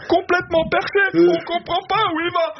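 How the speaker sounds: quoting listener comments, squeaky mocking voice; mocking online commenters. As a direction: parrot the critics in a silly squeaky voice. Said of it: "les commentaires sur Radio France".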